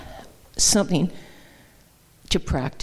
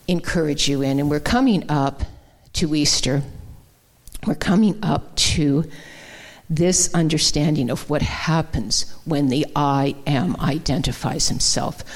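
usual speaking voice

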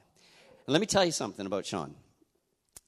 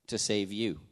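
ordinary talk

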